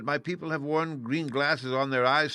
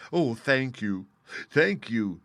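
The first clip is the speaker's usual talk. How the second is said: 1 grateful Scarecrow; 2 semitones higher, 5 dB louder, slower than usual. Acted. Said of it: "cried the Scarecrow".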